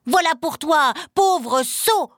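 furious mother shouting, high and loud; angry cry. Acted. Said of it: "entra dans une terrible colère et le gifla".

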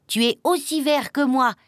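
high, happy chick voice; delighted little chick. A narrator's reading. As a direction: exclaim it with glad excitement. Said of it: "tout content".

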